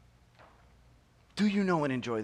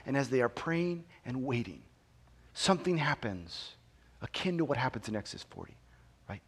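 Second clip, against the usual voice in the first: normal talking voice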